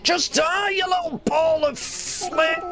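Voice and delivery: high-pitched raging shout; real high-pitched rage; furious yell. Real, not acted. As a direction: scream it high at an enemy. Yells at game enemy.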